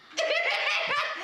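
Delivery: big burst of laughter; loud laugh. Real, not acted. Laughing at the nipple tweak.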